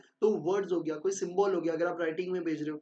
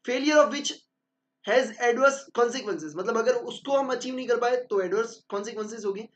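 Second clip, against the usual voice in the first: slow, deliberate dictation of a definition; slow textbook reading; 2 semitones higher, 3 dB louder, slower than usual. Acted. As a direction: read it out as if dictating notes. Reads definition slowly. (धीरे-धीरे notes लिखवाते हुए)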